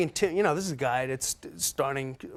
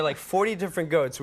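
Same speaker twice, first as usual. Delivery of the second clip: ordinary talk, nothing marked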